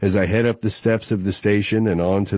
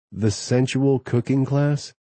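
teasing female voice; 6 semitones higher than usual. A narrator's reading. Josie's teasing question.

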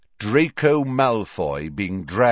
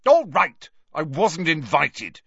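loud, higher-pitched snap; Malfoy, angry; 8 semitones higher, 4 dB louder than usual. A narrator's reading. Caught boy admits he had no invitation, angrily. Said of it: "he said angrily".

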